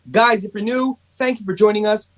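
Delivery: ordinary talk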